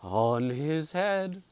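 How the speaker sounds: slow, high, hollow echo; the hall echoes the curse, slow and hollow; ghostly echo. A narrator's reading. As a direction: let it hang like a far ghostly echo. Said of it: "echoed the dim hall".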